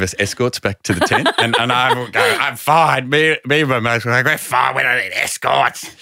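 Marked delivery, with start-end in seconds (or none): 2.4-3.1 mildly indignant, pitched higher; retold tipsy protest. Drunk, refusing an escort, retold. 4.2-5.9 mock indignant group protest; retold tipsy protest. Drunk mates refusing escorts, retold.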